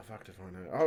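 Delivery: ordinary talk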